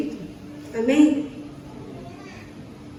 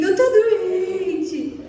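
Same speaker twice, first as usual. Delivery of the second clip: high, slow mock sob; mock crying; 5 semitones higher, much slower than usual. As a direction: whimper it like a child calling in sick. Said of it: "Eu choro pra falar que eu tô doente". (choramingando de brincadeira, agudo e lento)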